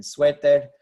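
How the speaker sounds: suéter punched loud on sué-, not -ter; Spanish suéter, hit the opening syllable; stress on first syllable. Shown as a demonstration. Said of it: "it should be T E R".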